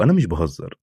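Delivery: ordinary delivery, nothing marked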